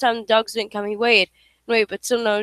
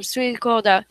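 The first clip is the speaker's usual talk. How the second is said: usual speaking voice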